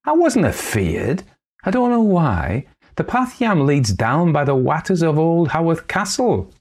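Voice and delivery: put-on high, light girlish voice; young girl. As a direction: tell it like an excited young lass. Girl tells her tale.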